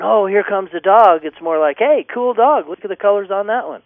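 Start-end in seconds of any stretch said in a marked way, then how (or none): 0.0-1.2 mock alarmed, higher and louder; hammed-up nervous owner; anxious. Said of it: "Instead of going". 1.8-3.8 breezy and confident, much higher, fast at the end; casual upbeat owner shrugging it off. Shown as a demonstration. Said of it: "Just have a confident attitude".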